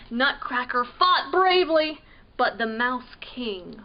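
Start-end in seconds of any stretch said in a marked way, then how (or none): none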